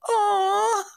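high loud mocking wail in someone's ear; imitating a loud crying wail. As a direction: wail it right in their ear. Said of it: "he'll come up to you and he go".

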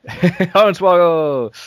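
laughing, drawn-out Hornswoggle! shot up high; laughing exclamation. Real, not acted. Echoing his friend's guess, laughing out the word Hornswoggle!.

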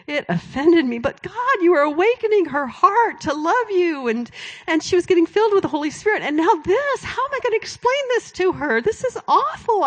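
high, anxious, pleading words to God; agitated retold prayer. Preacher reliving her alarm to God, high and anxious.

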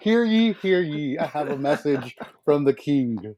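high, ringing proclamation voice; town crier. Pretend. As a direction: announce it like a herald in the square. Mock royal proclamation to guest.

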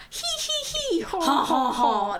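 a hammed-up cartoon chef cackle; French cook laugh. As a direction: imitate the Little Mermaid chef's song. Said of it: "that's what you sound like".

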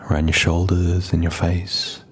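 low, even meditation voice with long pauses; calm, unhurried settling-in voice; guided meditation. Real, not acted. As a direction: gently guide listeners inward, leaving space between phrases.

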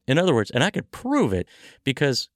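ordinary talk

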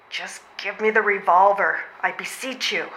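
ordinary talk, nothing marked

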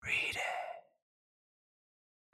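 eerie whispered command, drawn out slow; a ghostly voice urging them from nowhere; disembodied voice. Retold or imitated. Narrating the film, imitating the eerie disembodied voice. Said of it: "a random disembodied voice is just like".

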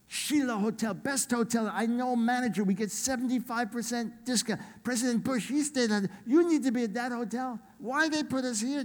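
put-on high-pitched, agitated accented complaint; very upset translator. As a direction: imitate an indignant translator protesting in broken English. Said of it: "Very upset every day".

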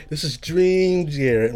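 slow, stretched-out name drop; drawn-out announcement. Pretend. Storyteller interrupting his dream tale, drawn-out announcement. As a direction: stretch the words like a DJ tag.

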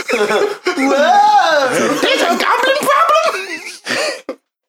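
high, drawn-out startled shopkeeper cracking up; hammed-up gnome shock; mock alarm. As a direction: squeal it out in fake panic, laughing.

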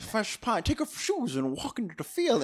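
lightly voiced old-movie leading man; drawled old Hollywood charm, lightly done; Jimmy Stewart impression. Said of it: "I'm doing my best Jimmy Stewart impression".

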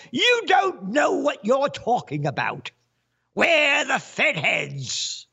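high, put-on shouting voice; mock yelling. Fed chief putting a critic in his place, high mock yell. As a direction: holler it high, drawing out the end. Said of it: "who yelled".

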